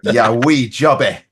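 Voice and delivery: loud, drawn-out Scottish accent; playful show-off Scottish phrase. Shown as a demonstration. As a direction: put on your best Scottish accent, slowly and loud. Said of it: "in your best Scottish accent".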